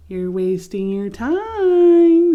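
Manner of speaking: taunting. Acted. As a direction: drag out the words to rub it in. Leaves taunting Leaf.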